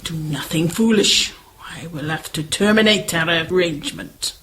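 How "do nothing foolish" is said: ordinary talk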